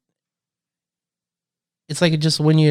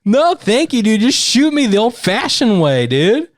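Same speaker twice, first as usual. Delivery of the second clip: pitched-up outrage; worked-up rant; 9 semitones higher than usual. Real, not acted. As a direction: rant in disbelief. Ranting about acid attacks.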